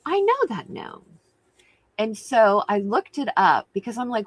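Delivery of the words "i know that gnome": high, startled "I know that"; a sudden jolt of recognition; squeaky surprised realization. Retold or imitated. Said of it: "I was like".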